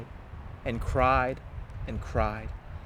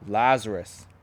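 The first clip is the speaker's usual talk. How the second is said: loud booming call, rising to a roar; mighty roar; 3 semitones lower, 9 dB louder than usual. A narrator's reading. Jesus calls Lazarus from the tomb, roaring loudly. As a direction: roar it like a lion. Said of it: "he yelled like a lion's mighty roar".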